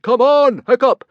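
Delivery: booming high shout across the deck. A narrator's reading. Father shouting to son to jump ship, loud call. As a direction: yell to his son over the flames. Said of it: "he turned back to his son and shouted".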